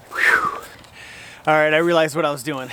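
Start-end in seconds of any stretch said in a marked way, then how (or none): 0.1-0.6 high, whistly exhale; whew of exertion. Real, not acted. Archer recovering after a second missed shot, whew. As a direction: blow out a recovering breath.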